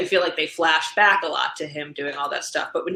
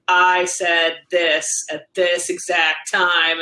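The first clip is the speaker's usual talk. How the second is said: slow, each word laid down as proof; telling her kids the exact time she said it, slow and pointed; pointed parent voice; 3 dB louder, much slower than usual. Retold or imitated. Spell it out word by word. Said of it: "as a parent to be able to be like".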